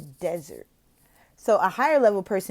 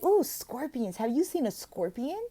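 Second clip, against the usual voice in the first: high, softer intrigued question; curious question; 5 semitones higher, 4 dB quieter than usual. Shown as a demonstration. Tutor asks about scorpions with intrigue. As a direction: wonder aloud with the child. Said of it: "And be like".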